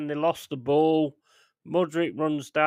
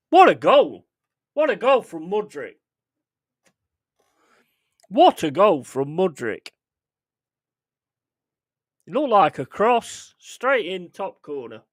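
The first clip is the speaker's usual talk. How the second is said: thrilled, higher and louder; excited; 5 semitones higher, 5 dB louder than usual. Real, not acted. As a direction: still buzzing from the goal. Reacting to the goal just scored.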